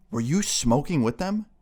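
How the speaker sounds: pointed mom questioning, lightly acted; accusing mom. Mom turning on the younger son, accusing mom. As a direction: imitate a mom grilling her younger son.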